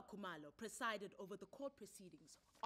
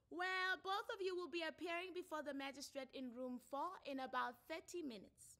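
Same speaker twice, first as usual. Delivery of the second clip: new tone; 9 semitones higher, 8 dB louder than usual. Acted. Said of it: "in a new tone of voice".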